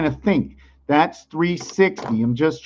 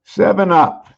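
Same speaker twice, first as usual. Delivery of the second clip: ordinary delivery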